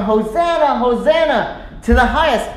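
celebrating crowd, pitched high; lifted, jubilant praise; excited. Acted. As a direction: cheer it out with excitement. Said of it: "People are excited".

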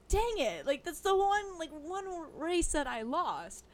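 high-pitched mock dismay; retold upset. Employee losing a race to a customer, comically upset. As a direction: play up a sore loser's frustration. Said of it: "I was actually pretty upset about it".

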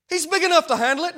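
full-force preaching; preached loud. Acted. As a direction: ring it out to the church.

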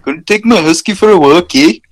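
playful mock Canadian. Shown as a demonstration. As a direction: ham up a folksy Canadian accent. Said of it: "our accent people think we have".

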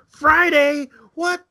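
high, shocked yelp; mock outrage. Customers hearing of a Friday restock, shocked high cry. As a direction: squeal it like a stunned customer. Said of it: "people were losing their shit".

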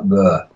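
low, dull noise of boredom; listless "bleh". Retold or imitated. Describing an overcast rubbishy Sunday, listless bleh. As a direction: let out a low, fed-up bleh. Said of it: "it was just like".

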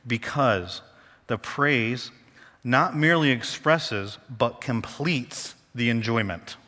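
slow, weighted quote with pauses; spaced-out, emphatic slow reading; really slow reading. Real, not acted. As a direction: read it out phrase by phrase, very deliberately. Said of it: "I'm gonna read this really slow".

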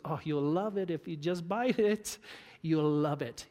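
lightly voiced devil whispering sweet promises; tempting Satan. Preacher voicing Satan offering the fruit, mildly coaxing. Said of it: "He goes".

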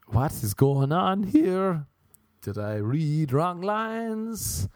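high, slow, rising questions; slow, incredulous high pitch; acted bafflement. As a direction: draw it out high in disbelief. Acts out baffled reaction.

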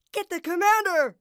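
loud, high bray; braying guard. A narrator's reading. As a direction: bray an urgent order. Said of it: "brayed a third".

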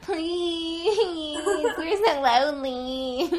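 high, whiny and pleading; whiny baby voice. Pretend. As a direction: whine like a needy baby. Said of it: "Are you trying to do Aziz's voice?".

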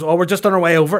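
loud, high, hurried reply; mock urgent dad. Son mimics dad heading over for the baby, loud urgency. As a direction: imitate a dad rushing out the door. Dad rushing after prank call.

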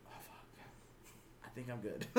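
barely audible whispered aside; very soft, secretive self-talk; hushed dread. Acted. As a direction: murmur it under your breath. Acts his own dread at getting caught.

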